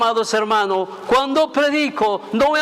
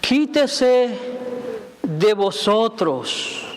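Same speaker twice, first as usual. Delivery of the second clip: very slow reading; much slower than usual. Shown as a demonstration. Pastor reading Ephesians to the congregation very slowly. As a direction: read the verse word by word with long pauses. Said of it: "Se lo voy a leer bien despacito".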